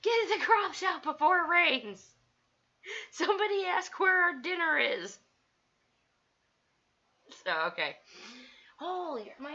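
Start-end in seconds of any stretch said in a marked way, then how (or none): 0.0-5.2 loud, high call across the field; mock farm holler. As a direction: holler it out like a hammed-up hero call. Acting out a farm morph call.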